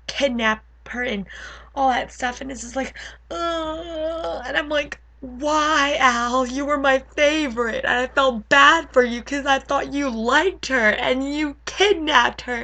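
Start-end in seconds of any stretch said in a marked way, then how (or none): 3.3-4.4 high whiny "ugh"; dismayed groan. Acted. Dismayed reaction to kidnapping. 5.2-12.6 higher, wailing reproach; betrayed pleading. Acted. Mock scolding of betraying character.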